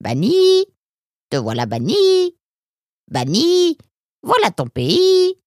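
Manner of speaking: parrot voice. A narrator's reading. Parrot repeats its refrain.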